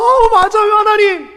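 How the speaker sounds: high, loud, thrilled agreement; overjoyed response. Acted. Believer responding to God's word, overjoyed and loud. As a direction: burst out, moved and delighted. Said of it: "이렇게 감격하며 반응하는".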